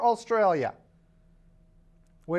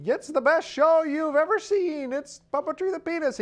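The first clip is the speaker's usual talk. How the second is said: TV ad announcer; 9 semitones higher than usual. Retold or imitated. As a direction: sell it like a TV spot. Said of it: "it'd be".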